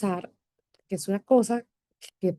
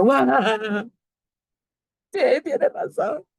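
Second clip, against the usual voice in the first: high, slow "wow" of being caught out; mock realization; 6 semitones higher, 5 dB louder, much slower than usual. Mother caught lying to therapist, stunned realization. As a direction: struck by the truth, drawn out. Therapist calls out her lie.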